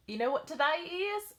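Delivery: put-on high, sweet Southern child voice; little boy voice. Little boy asking his dance teacher, high child voice. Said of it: "I have to do his voice".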